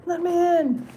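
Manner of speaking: high, far-off voice begging to come in; a spirit pleading to be let in; pleading at the door. Pretend. Spirit at the door begs her, high pleading voice.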